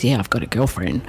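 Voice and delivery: low-pitched, laid-back male animal voice; kangaroo's reply. Retold or imitated. Missing kangaroo answering the psychic, low and matter-of-fact. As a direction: drop low for the kangaroo's confession.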